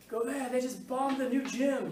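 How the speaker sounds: hammed-up panic, calling the news out; panicked report. Teacher acting a student running in with news, hammed-up panic.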